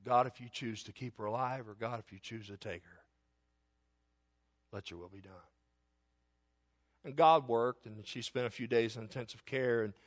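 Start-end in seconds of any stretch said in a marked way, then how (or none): 0.0-5.3 hushed prayer. Retold or imitated. Pastor re-voicing his prayer.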